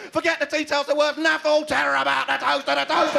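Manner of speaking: high, exasperated urging; frantic fan. Acted. Fan telling the contestant about the toaster, frantic.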